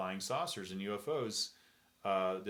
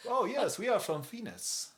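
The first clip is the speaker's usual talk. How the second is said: high-pitched mock German accent; 12 semitones higher, 4 dB louder than usual. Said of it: "they're talking in a German accent".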